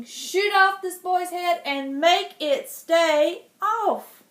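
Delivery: slow, booming kingly command; king ordering the archer, loud and slow; loud royal order. Acted. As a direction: pronounce it like a death sentence.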